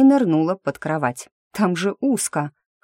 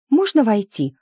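high, louder, impatient question; 7 semitones higher, 5 dB louder than usual. A narrator's reading. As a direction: ask urgently to be let in. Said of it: "спросила она нетерпеливо".

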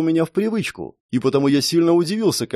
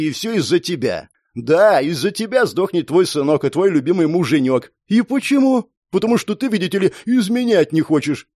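heated, raised-pitch tirade; angry berating; 5 semitones higher than usual. Acted. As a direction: lay into her like an angry bully. Thug berates the woman. (злобная тирада)

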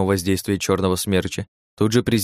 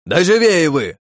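yelling; 9 semitones higher, 6 dB louder, slower than usual. Acted. Leader yelling at his troops.

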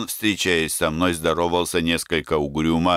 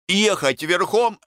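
surprised question; 11 semitones higher than usual. A narrator's reading. Startled Semyon questions the order.

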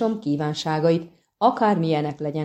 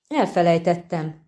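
usual speaking voice

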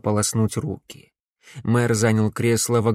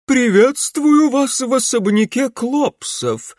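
much higher, louder, slow haughty lady; grande dame; 13 semitones higher, 5 dB louder, slower than usual. A narrator's reading. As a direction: greet guests like a pompous hostess. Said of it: "надменно произнесла она".

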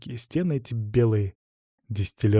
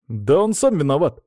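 higher, louder outburst; exclaimed protest; 5 semitones higher, 5 dB louder than usual. A narrator's reading. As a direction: blurt out the accusation. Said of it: "воскликнул Антон". (протестующий возглас, громче и выше)

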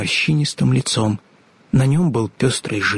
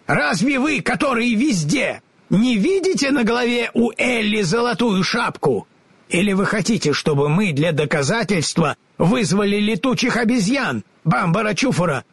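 high, eager scarecrow; 9 semitones higher than usual. A narrator's reading. Scarecrow reminds the wizard of his brains, eager. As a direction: blurt it out like a straw man.

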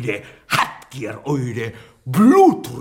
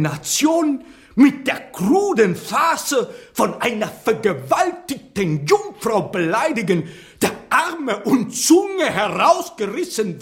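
higher and louder, building into a shout; rising rant; 9 semitones higher, 3 dB louder than usual. Acted. Lope raging at Shakespeare over his bloody play, a building furious shout. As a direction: rail at them, climbing to fury. Lope rails against Titus Andronicus.

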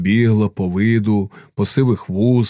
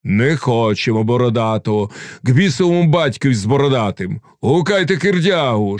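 plain reading voice

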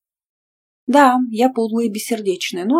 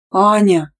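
loud, reproving name call; exasperated, indignant cry; indignant exclamation; 7 dB louder than usual. A narrator's reading. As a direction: protest in one loud word. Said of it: "возмутилась я".

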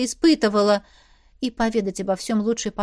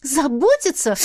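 snorted; 5 semitones higher, 5 dB louder than usual. A narrator's reading. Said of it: "фыркнула".

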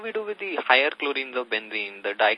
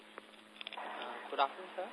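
very faint, far from the phone; speaking too far from the mic, much softer; barely audible; 15 dB quieter, slower than usual. Real, not acted. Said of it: "Aman, your voice is very low".

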